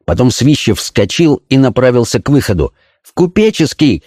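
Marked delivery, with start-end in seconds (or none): 3.0-4.0 higher-pitched call without real volume; mild narrated shout. A narrator's reading. Kalinovsky calls to a man heading for the exit, mild shout. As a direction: call it out lightly, pitch raised. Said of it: "крикнул ему Калиновский".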